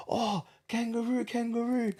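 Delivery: high, eager hawker calling out; mock the sellers' pushy welcome; hammed-up street vendor greeting; market seller voice. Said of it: "sellers always do kangaroo".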